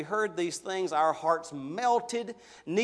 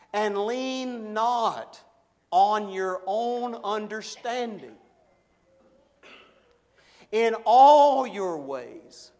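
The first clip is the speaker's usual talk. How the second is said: slow, swelling recitation, "all" hit hard; slow, loud, reverent reading of the verse; solemn scripture recitation; 3 semitones higher, 7 dB louder, much slower than usual. Real, not acted. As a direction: intone the verse slowly, building on "all". Reciting Proverbs 3:5-6.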